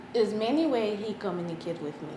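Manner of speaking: normal talking voice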